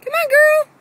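high, loud call across the yard; shouting for the dog to come. Real, not acted. Calling the dog from afar.

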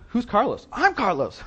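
high, loud mock back-and-forth; hammed-up exchange. Confused consultant questions third-person caller, high and loud.

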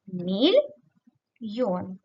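"Мільйон" said slowly as Міль, pause, йон; split into syllables. Shown as a demonstration. Teacher dividing the word for pupils, syllable by syllable. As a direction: break "Мільйон" into two separate syllables. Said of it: "Поділимо це слово на склади".

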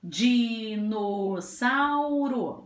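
"Dinossauro" very slow, each vowel held; slow, stretched. Shown as a demonstration. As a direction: repeat "Dinossauro" as four long beats. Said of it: "Vou falar a palavra devagar".

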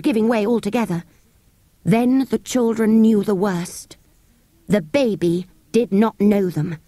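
ordinary delivery

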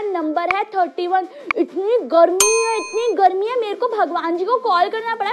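ordinary talk, nothing marked

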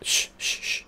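unvoiced-breath shush, repeated. Real, not acted. Player quieting the game's baby, shushing.

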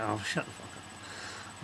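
slow, breathy whisper of disgust; whispered aside. Retold or imitated. Whispering about the annoying Australian guy, fed up. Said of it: "he's just like".

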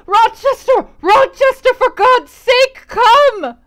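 high, full-force screamed summons; desperate scream for help. Acted. Victim upstairs calls Rochester, desperate high scream. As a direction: scream as if through a floor to be saved. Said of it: "I distinguished through plank and plaster".

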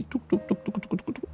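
quiet unvoiced tuc tuc tuc, playful sound effect; soft clicking mouth sounds while building; clicky placing noises. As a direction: tap out little clicks under your breath.

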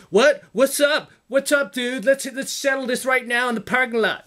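high, puffed-up schoolboy challenge; the mean-mugging guy picking a fight, mocked; mocking tough punk. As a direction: mimic a boy itching for a parking lot fight. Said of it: "mean mugging dudes who are like".

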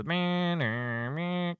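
droning buzzer-like "meh" blasts; imitated intruder alarm. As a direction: mimic a repeating alarm buzzer. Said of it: "kinda intruder alarm".

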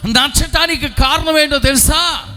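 loud, high preaching with a shout at the end; preaching at full force. Acted. Pastor calls to his congregation, preaching at full force. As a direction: drive it home to the congregation at full voice.

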